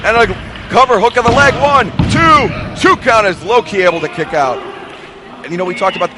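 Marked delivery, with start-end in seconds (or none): 0.1-4.6 excited call. Real, not acted. Near fall called live.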